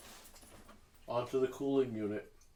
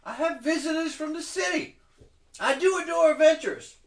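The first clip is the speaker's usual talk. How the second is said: much higher and much louder, theatrical indignation; grand halfling hostess; 12 dB louder than usual. Acted. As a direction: sweep in and scold like a diva expecting applause. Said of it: "walks like someone expecting applause".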